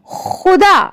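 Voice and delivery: खुदा with a throaty x, khuda; nukta kh. Shown as a demonstration. Teacher saying खुदा with a throaty kh for pupils. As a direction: start खुदा with a scraped kh like loch. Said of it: "यानी कि".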